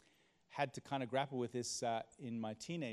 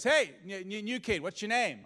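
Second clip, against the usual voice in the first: gruff teacher; 8 semitones higher, 8 dB louder than usual. Retold or imitated. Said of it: "and, and he goes".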